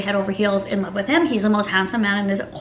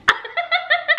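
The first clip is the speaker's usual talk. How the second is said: high laugh. Real, not acted. Laughs at own joke.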